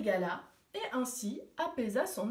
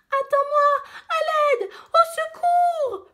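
high, loud desperate cries for help; panicked screaming; 9 dB louder than usual. Acted. Little girl screams as her baby brother slides away, panicked. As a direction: scream after the runaway sack. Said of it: "La petite esquimaude hurla".

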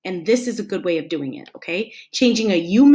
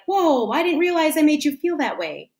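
higher, gently surprised realization; mild surprise, pitched up; taken aback; 7 semitones higher than usual. Acted. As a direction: react as if it only now dawns on you. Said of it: "and they're like".

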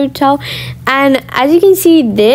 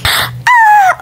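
very high gasp and squeal; high short squeal; delighted shriek. Real, not acted. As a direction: squeal at the pool. Spotting the pool.

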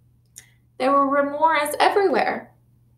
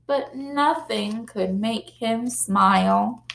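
plain reading voice

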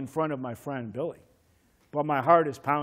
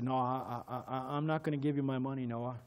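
timid refusal; 3 semitones lower, 9 dB quieter than usual. Retold or imitated. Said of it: "I said".

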